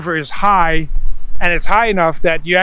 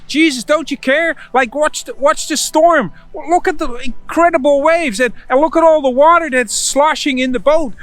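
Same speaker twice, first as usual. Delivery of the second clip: much higher, frantic pleading voice; panicked disciples; 8 semitones higher than usual. Acted. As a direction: plead like terrified disciples in a sinking boat. Panicked disciples wake Jesus.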